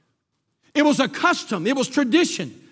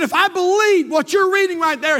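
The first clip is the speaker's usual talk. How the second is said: impassioned plea; 8 semitones higher, 4 dB louder than usual. Retold or imitated. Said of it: "he said".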